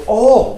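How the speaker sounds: ALL alone, punched loud and high; ALL thrown out as its own loud word; stress on ALL. Shown as a demonstration. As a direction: hammer the single word All. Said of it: "The emphasis here is on the word all".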